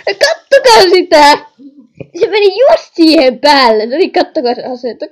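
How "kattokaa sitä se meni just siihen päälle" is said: can't believe it, yell it out; excited shouting. Real, not acted. Gamer shouting to viewers about Granny, excited loud yell.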